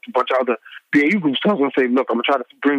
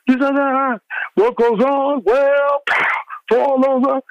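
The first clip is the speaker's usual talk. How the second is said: pitched-up mock radio promo voice; imitating promo rant; 11 semitones higher, slower than usual. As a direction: ham up the promo's wild narration, way up high. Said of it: "a tale of what happened".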